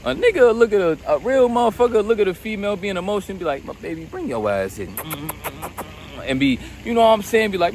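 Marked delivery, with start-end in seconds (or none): 3.6-4.9 a soft low murmur of comfort; tender coaxing. Retold or imitated. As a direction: lower and softer, soothing a partner. Real man soothing his woman.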